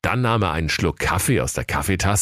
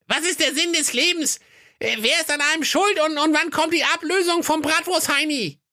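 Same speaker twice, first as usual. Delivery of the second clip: very high shrill granny voice; a shrill devilish old receptionist. A narrator's reading. The devil's grandma greets the visitor, very high shrill voice. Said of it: "fragte die Omi".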